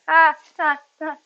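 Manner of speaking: short loud burst of laughter; laughing at own joke. Real, not acted. Streamer laughing at her own pun. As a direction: crack up at your own joke. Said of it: "I cracked myself up".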